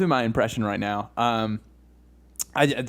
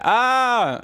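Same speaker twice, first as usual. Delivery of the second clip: high, loud pained scream; Jerry Seinfeld; 11 semitones higher, 6 dB louder than usual. Acted. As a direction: scream on demand as if in agony. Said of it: "Can we do Jerry Seinfeld again?".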